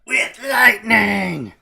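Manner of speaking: loud, drawn-out villain cry; Emperor Palpatine. Acted. Evil emperor adds lightning to happy trees, loud and hammy. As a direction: blast it out slowly, hammed-up and high. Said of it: "now I have Emperor Palpatine".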